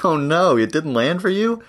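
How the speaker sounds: high, teasing fake shock; mock surprise. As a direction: gasp in playful disbelief. Mock dismay at a joke.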